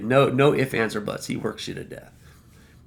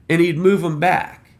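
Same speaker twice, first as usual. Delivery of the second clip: ordinary delivery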